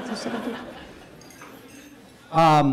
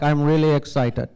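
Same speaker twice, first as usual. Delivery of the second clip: low, flat monotone announcing joy; deadpan excitement; 6 semitones lower than usual. Retold or imitated. A Pakistani dryly saying he's thrilled, deadpan. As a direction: claim excitement with no excitement at all. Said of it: "not as excitable".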